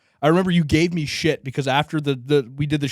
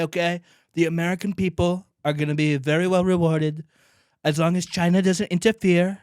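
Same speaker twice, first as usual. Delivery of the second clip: higher, punchy rally-speech voice; Trump impression; 4 semitones higher than usual. Podcast intro requested by cohost, Trump impression. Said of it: "give me a Trump one for the intro".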